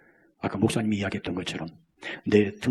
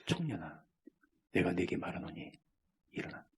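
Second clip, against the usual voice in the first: quiet, lower gentle command; soft hushed command; 4 semitones lower, 13 dB quieter than usual. Acted. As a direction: speak it softly to the dead young man. Jesus raises widow's son. (조용하고 낮은 부드러운 명령)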